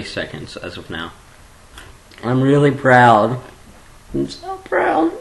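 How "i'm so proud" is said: tiny, very high, slow voice; gushing squeal. Pretend. Vlogger gushing to viewers with pride, high drawn-out squeal. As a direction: squeak it out, overcome with mock pride.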